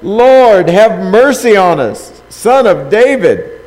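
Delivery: loud, high, slow cry for mercy; shouted plea. Acted. Blind men by the roadside begging Jesus, loud pleading shout. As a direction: call out desperately over the crowd. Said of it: "they shouted".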